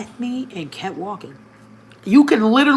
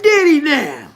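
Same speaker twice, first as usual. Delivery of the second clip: higher, louder, teasing question; playful surprise; 8 semitones higher, 6 dB louder than usual. Retold or imitated. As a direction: tease him with mock surprise. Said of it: "I said".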